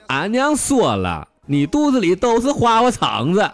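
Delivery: quoting mother in a coy squeal; mantou's voice. Acted. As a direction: put on a coy high voice. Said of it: "馒头就说".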